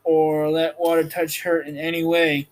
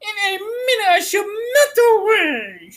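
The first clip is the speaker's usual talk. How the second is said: panicked witch. Acted. Witch melting, crying out.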